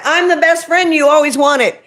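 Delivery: normal talking voice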